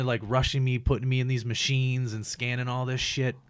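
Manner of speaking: usual speaking voice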